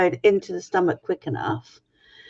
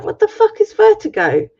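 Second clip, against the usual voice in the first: much higher, outraged disbelief; incredulous outburst; 14 semitones higher, 3 dB louder than usual. Retold or imitated. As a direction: squeal it in shocked disbelief. Mum retells doctors' diagnosis.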